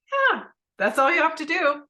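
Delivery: high, loud and bright, emphatic yes; cheerful husband saying it's that simple; bright eager reassurance; eager husband agreeing. Retold or imitated.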